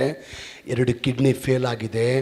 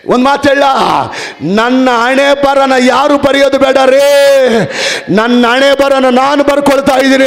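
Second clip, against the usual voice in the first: loud, higher preaching; preaching at full force; 9 semitones higher, 10 dB louder than usual. Acted. Preacher rousing the congregation against fate, loud. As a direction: hammer the point home to the church.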